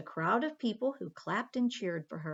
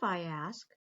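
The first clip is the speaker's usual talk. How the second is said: plain reading voice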